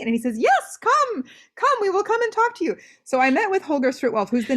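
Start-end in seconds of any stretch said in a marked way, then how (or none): 0.4-2.8 lightly voiced German man, much higher; a hint of an eager German host inviting her; sweet German man. German editor inviting the student to meet, lightly voiced, much higher. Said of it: "very sweet German man".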